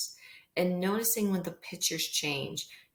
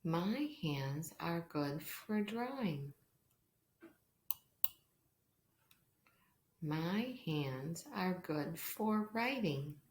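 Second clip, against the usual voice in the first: slow, hushed storybook reading; reading to a toddler; 7 dB quieter, slower than usual. Acted. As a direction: read slowly and softly for young children. Reads pattern book aloud.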